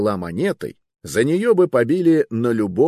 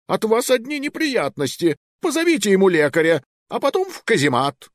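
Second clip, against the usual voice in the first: annoyed king; 12 semitones higher than usual. Acted. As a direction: bark out the orders. King scolds and orders.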